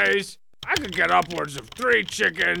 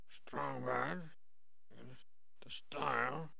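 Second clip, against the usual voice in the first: far off; 4 semitones lower, 6 dB quieter than usual. Acted. Lost in dark catacombs.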